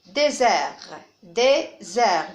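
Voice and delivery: désert sounded out in two beats, dé-zert; teacher's careful syllable demo of "désert". Shown as a demonstration. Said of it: "je vais les découper en syllabes".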